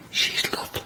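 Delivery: soft conspiratorial whisper, barely voiced; whispered aside. Real, not acted. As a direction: lean in and whisper it so she can't hear. Aside about the contestant, half-hidden.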